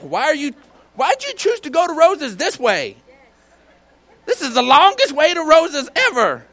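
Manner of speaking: high, exasperated backseat complaint; irritated passenger. Acted. Passenger to driver about the long route, irritated. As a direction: gripe loudly at the driver's choice of route. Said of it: "we're irritated at the other drivers".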